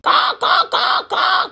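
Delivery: high crow cawing; crow caws. Acted. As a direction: caw like a crow. Imitating crow calls.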